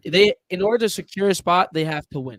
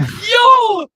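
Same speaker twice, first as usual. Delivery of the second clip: surprised yelp; 10 dB louder than usual. Real, not acted. Surprised laughing outburst.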